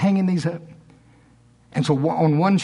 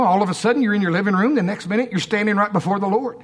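usual speaking voice

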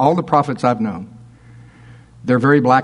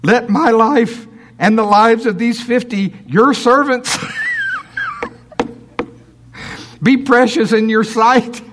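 higher, pleading plea for his life; begging captain; 7 semitones higher than usual. Acted. Captain on his knees pleading with Elijah. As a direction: plead like a kneeling soldier. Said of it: "He's just begging for his life".

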